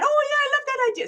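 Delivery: fast squeaky imitation; high mock voice. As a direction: pitch way up, rushed and gushing. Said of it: "And then Shan".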